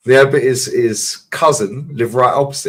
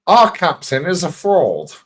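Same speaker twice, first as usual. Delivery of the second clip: put-on Brian Clough accent; 6 semitones higher, 4 dB louder, slower than usual. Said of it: "the way Dean Saunders nails his fucking accent".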